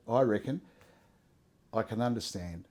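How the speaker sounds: gentle God. Pretend. God calmly reassuring an upset believer, soft and low. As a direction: soothe like a patient father, quiet and low. Voicing God's calm reply.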